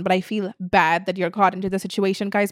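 ordinary talk

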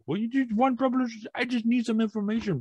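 high-pitched mock Jamaican caller; heavy Jamaican accent. Caller asking for personal information, heavy Jamaican accent. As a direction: imitate a wheedling Jamaican telemarketer. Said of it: "you have a very heavy accent".

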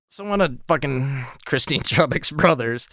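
holding back giggles; suppressing laughter while talking; trying not to laugh. Real, not acted. Trying to continue, still laughing.

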